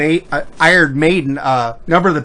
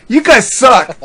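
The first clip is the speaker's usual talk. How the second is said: mock indignation; 7 semitones higher, 5 dB louder, slower than usual. Retold reply to being pranked.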